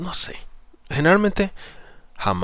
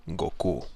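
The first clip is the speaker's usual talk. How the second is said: GOKU soft and low, ending level without a lift; neutral ending on GOKU; 4 semitones lower, 7 dB quieter than usual. Shown as a demonstration. Coach demonstrating a middle intonation, GOKU ended neutrally. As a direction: say GOKU with an even, understated ending. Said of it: "una entonación media".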